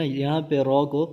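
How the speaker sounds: ordinary talk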